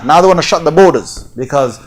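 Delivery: ordinary talk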